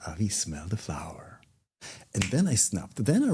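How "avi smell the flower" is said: soft unvoiced whisper. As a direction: whisper it gently close to the ear. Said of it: "touches me, like, here and say".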